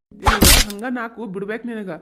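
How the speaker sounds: loud threatening yell; threatening shout. Acted. Husband shouting at his wife, threatening to hit her. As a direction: bark a threat to slap her.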